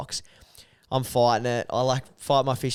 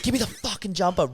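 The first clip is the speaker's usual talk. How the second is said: higher-pitched imitated outburst; mock urgent demand; 8 semitones higher than usual. Mate runs over for the jumper, mock urgent demand.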